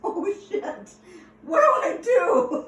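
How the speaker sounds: high, loud, slow panicked cry. Retold or imitated. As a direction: yelp it out in terror, drawn out. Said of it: "And so I'm like".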